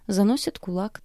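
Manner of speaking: usual speaking voice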